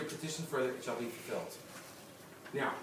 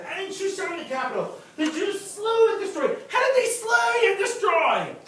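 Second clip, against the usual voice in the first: rising shout; 10 dB louder than usual. Acted. Preacher building to a climax.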